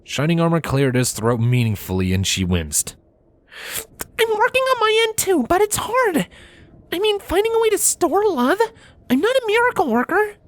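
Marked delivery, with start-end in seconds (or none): 3.9-10.3 high mare voice, a bit exasperated; a princess pony complaining; Cadance voice. A narrator's reading. Cadance grumbling to her husband, high voice.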